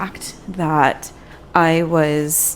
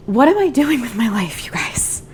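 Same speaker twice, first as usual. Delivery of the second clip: higher exasperated whisper to herself; 6 semitones higher than usual. Real, not acted. Vlogger venting to viewers about her job, whispered. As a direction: breathe out the question in disbelief. Frustrated rhetorical question, whispered.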